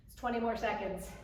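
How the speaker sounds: normal talking voice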